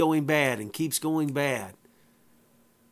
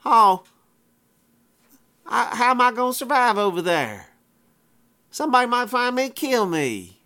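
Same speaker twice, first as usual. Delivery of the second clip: higher, whiny mock crying; mock crybaby whine; 7 semitones higher than usual. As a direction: whimper it like a big crybaby, half in jest. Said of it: "Cain begins to cry like a crybaby and says".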